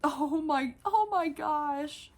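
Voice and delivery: loud, high, slow oh my gosh; overwhelmed by cuteness; thrilled outburst; excited gush. Real, not acted. Toy just spoke.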